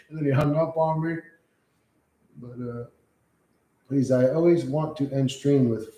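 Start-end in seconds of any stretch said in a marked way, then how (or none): none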